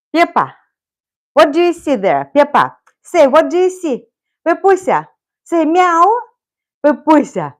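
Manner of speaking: high, loud coaxing call; calling the cat. Real, not acted. Owner coaxing her cat to meow, high and loud. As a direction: coax the cat to answer, high.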